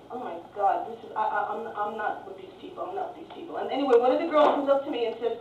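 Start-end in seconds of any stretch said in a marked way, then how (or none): 0.1-3.6 low, hushed disowning; embarrassed aside. Retold or imitated. Woman quietly distancing herself from the Americans, muttered low. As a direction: shrink away under your breath. Recalled embarrassed thought among loud tourists.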